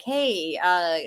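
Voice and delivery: ordinary delivery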